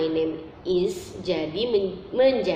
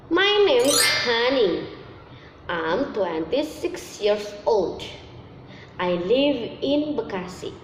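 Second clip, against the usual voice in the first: example sentence; 2 semitones higher, slower than usual. Shown as a demonstration. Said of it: "misalnya contohkan ya".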